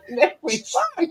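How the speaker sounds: high, slow, giggling through it; laughing repeat. Real, not acted. Repeating a friend's line while laughing hard. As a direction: laugh the words out.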